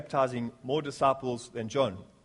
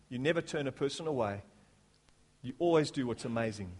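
hushed prayer; 3 dB quieter than usual. Real, not acted. Pastor closing a prayer for seekers, hushed. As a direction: let the prayer sink gently toward the amen.

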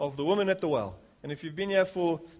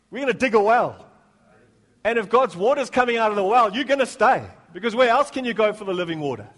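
loud, higher-pitched preacher's exhortation; impassioned preaching; 7 semitones higher, 7 dB louder than usual. Real, not acted. Preacher rallying church to dig a well, impassioned. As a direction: drive the message home to the whole room. Preaching the sheep story's point.

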